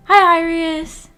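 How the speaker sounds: ordinary talk, nothing marked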